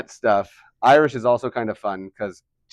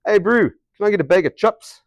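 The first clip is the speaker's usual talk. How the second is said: high, loud-ish Kiwi, "chips" as "chups"; New Zealand accent; 7 semitones higher, 4 dB louder than usual. Shown as a demonstration. Shopper asking for chips in Christchurch, in New Zealand accent. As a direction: hail a mate in broad Kiwi. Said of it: "we wind up in Christchurch".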